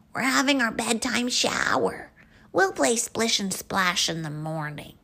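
firm but friendly brush-off; busy elephants. Acted.

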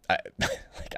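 breaks into a real laugh; a quick laugh that cuts off the sentence; real burst of laughter. Real, not acted. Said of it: "I'm sorry I'm laughing".

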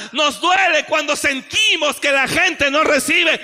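high, loud sustained preaching cry; impassioned preaching. Real, not acted. Pastor sharing his pain with the congregation, preaching loudly. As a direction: preach it at full force.

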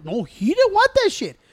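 high-pitched imitation of an insistent store worker; store worker's answer. Gap store worker answering questions about [name], high-pitched and emphatic.

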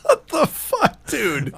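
high, slow, through laughter; cracking up while saying it; real laughing disbelief. Real, not acted. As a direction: drag it out, helpless with laughter. Cracking up at dark joke.

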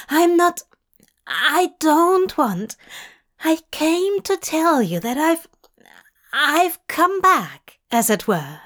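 high, halting flustered stammer. Acted. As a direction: stumble over every start. Aziraphale stammering through door.

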